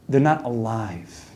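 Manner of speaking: slow and soft, almost under the breath; hushed, slow, grave. Real, not acted. Rabbi telling listeners the wicked aren't alive, soft and slow. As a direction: lay the verdict down quietly. Rabbi's punchline about the disconnected.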